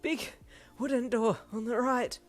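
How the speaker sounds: high, loud, out of breath; puffing. A narrator's reading. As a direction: gasp out directions, winded. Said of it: "Walter puffed".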